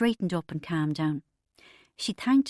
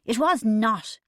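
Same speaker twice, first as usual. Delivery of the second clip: irritably; 4 semitones higher, 6 dB louder, slower than usual. A narrator's reading. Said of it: "Dermot said irritably".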